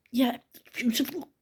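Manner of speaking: a hint of a hushed mother's reply; hushed reassurance. Grandmother telling her daughter the baby is beautiful, hushed. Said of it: "like that to her".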